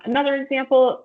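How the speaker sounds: usual speaking voice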